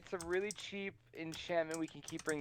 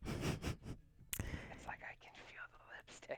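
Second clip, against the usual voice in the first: right in your ear; 11 dB quieter, much slower than usual. Acted. Joins the close-mic whisper.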